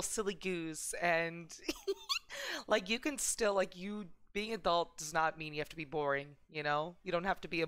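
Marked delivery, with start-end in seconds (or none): 1.6-2.6 a sudden squeaky giggle; high giggle. Real, not acted. Streamer giggling at being a silly goose. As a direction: laugh up high at yourself. Laughs at own silliness.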